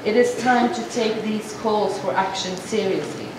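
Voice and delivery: usual speaking voice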